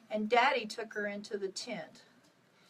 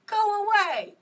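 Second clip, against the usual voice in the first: high, loud panicked yell at him; child's scream; 11 semitones higher, 10 dB louder than usual. A narrator's reading. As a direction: scream it at the rider, terrified. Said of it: "she screamed at him".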